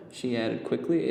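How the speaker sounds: plain reading voice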